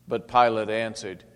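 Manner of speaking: ordinary delivery, nothing marked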